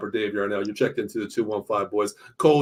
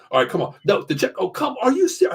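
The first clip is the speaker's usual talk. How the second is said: imitating himself yelling at the TV, rising higher; a hint of game-day yelling, climbing up; mild mock screaming; 11 semitones higher than usual. Retelling his yelling at the game: mild mock screaming. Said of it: "I'm, like, screaming".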